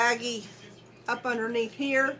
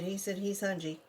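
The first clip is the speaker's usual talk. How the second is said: ordinary talk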